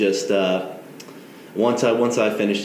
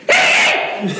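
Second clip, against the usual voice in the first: loud whooping yell, much higher; hillbilly holler; 10 semitones higher, 5 dB louder than usual. Shown as a demonstration. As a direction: let rip a wild backwoods holler. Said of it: "I'll see if I can get you one here".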